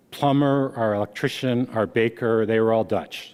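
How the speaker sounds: usual speaking voice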